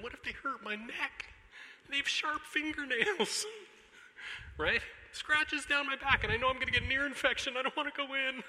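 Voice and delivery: high, whiny, hammed-up worrying; whiny scared parent. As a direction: list the fears like a fussy child. Whiny parent's imagined fears.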